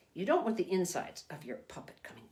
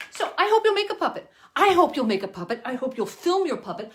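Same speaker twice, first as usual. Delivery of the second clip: high, loud, eager urging; excited; 9 semitones higher, 9 dB louder than usual. Real, not acted. Puppeteer urges the children to make puppets, excited. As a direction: beg them to make a puppet. Enthusiastic plea to viewers.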